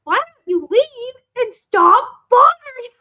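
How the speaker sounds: high, loud, drawn-out cartoon kid. Cartoon kid telling visiting friend off, high loud voice. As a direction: imitate a bratty kid dismissing someone, stretching the words. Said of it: "D W just straight face, happy smile goes".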